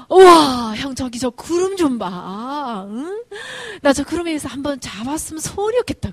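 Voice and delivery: awestruck little brother. Acted. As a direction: gush to your brother in amazement. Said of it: "구름을 보고 감탄을 해요". (크게 감탄하는 어린 동생처럼)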